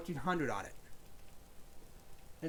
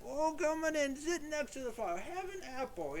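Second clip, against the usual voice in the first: welcoming host; 10 semitones higher than usual. Retold or imitated. Old man inviting the shivering boy to the fire, high and sweet. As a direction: coax the naked boy in kindly. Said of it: "And he's like".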